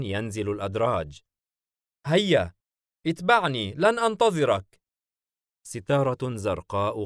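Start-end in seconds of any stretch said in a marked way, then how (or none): 2.1-4.8 high, louder summons; calling out. A narrator's reading. Leviathan calling the child to follow him downstairs, calling out. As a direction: hurry someone along from the stairs.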